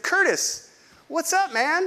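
a friend's high cheerful hello, lightly mimicked; imitating Travis. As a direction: pitch way up for a buddy's cheery hello. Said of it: "Travis said".

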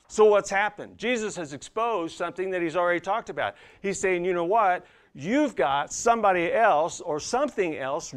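high, driving pulpit delivery; forceful preaching. Real, not acted. Preacher citing Jesus on two masters, preaching forcefully. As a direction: press the warning on the congregation.

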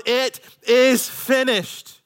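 very slow, high, loud cry; preached yell. Retold or imitated. Preacher voicing Jesus's last cry, slow preached yell. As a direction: proclaim it word by word with full force. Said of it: "gave up his last breath and yelled".

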